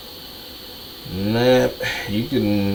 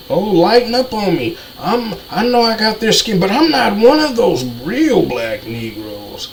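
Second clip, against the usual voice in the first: mocking quote; 8 semitones higher than usual. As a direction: mimic them with a sneer, pitched up. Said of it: "That's what you basically saying".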